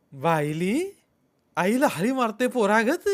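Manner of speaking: high, loud taunt; mock him for calling like a child; jeering mockery. A narrator's reading. Shepherd mocks Rama for calling his mother, loud jeer. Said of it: "कुसाळकीचं हसणं हसला".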